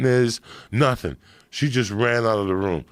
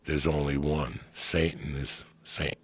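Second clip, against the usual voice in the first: low grave priest; 8 semitones lower, 8 dB quieter than usual. Retold or imitated. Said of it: "he looks at him, he goes".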